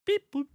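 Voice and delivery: high robotic beep boop. Pretend. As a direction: chirp it like a little robot. Imitated robot noise.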